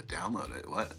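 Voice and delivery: quiet, low mock-baffled question; baffled mimicry. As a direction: imitate a clueless kid not getting it. Retold baffled youngster's reply.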